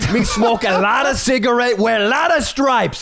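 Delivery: high, broken-English mock French accent; Poha Jirafa. Character introduces himself as French, high hammy French accent. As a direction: boast as a cartoon French guy. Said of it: "just do Poha as a French guy".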